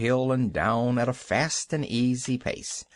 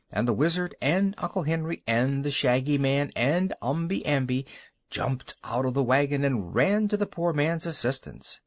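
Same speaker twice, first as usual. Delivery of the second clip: usual speaking voice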